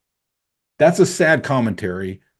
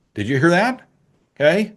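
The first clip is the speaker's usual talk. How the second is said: ordinary talk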